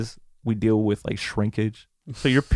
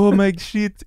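put-on high-pitched French-accented dad exclamation; 9 semitones higher, 4 dB louder than usual. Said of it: "saying with his French accent".

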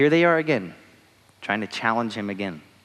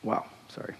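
choked up; 12 dB quieter than usual. Real, not acted. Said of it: "a little choked up".

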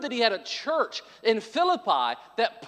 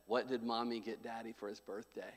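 conspiratorial hush; 10 semitones lower, 15 dB quieter than usual. Retold or imitated. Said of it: "and I said".

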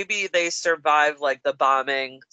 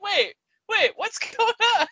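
much higher, bewildered "wait, wait"; high puzzled question; confused. Retold or imitated. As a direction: caught off guard. Retold confusion.

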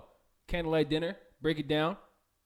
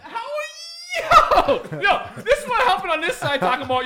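laughing shout; 9 dB louder, slower than usual. Real, not acted. Bursting out laughing at answer.

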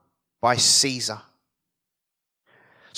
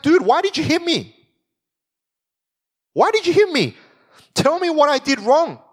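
indignant protest; 9 semitones higher than usual. Retold or imitated. Teacher paraphrases Jesus's indignant protest.

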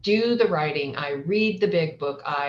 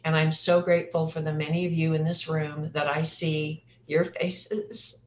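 usual speaking voice

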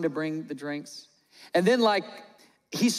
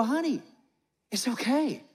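higher-pitched, encouraging parent voice; acted sweet reassurance, pitched up; doting parent; 4 semitones higher than usual. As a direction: gush supportively to your nervous daughter. Acts parent comforting daughter waitress.

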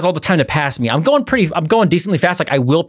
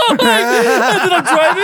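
normal talking voice

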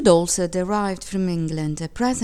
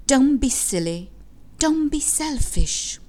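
firm, raised scolding voice; higher, emphatic telling-off; scolding mother; 6 semitones higher, 4 dB louder than usual. A narrator's reading. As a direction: scold a child, stretching the last rebuke.

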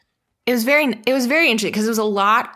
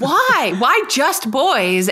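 mock outrage; 6 semitones higher, 4 dB louder, much slower than usual.

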